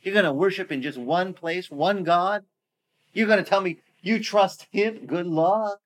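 mock them like a schoolyard bully; taunting bully. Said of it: "So they're like".

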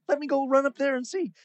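put-on high, loud, eager girl's voice; Mary can't wait to run to Elizabeth, eager and high.

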